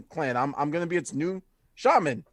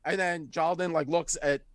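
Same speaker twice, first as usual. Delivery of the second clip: ordinary talk, nothing marked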